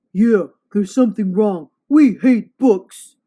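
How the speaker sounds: grumpy monster. Acted. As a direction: bellow it like a cranky beast. Monster complaining loudly.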